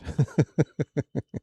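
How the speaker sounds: light run of chuckles; amused chuckle. Real, not acted. Announcer imagining a hot-water prank, amused chuckle. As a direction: chuckle at your own joke. Laughs at own practical joke.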